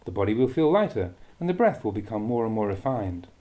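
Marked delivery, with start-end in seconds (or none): none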